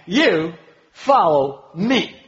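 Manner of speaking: YOU... FOLLOW... ME, very slow and separated; drawn-out, loud stress on YOU, FOLLOW and ME; emphatic on every word. Acted. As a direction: stretch and punch each word of "You follow me".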